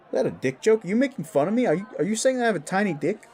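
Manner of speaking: high, indignant string of questions; offended. Acted. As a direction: take offence, pitch shooting up. Offended at a perceived insult.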